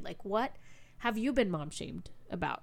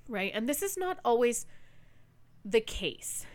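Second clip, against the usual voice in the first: ordinary talk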